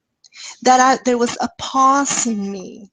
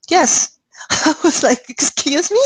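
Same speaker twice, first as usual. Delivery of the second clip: laughing; 6 semitones higher than usual. Real, not acted. Laughing in disbelief at girl's answer.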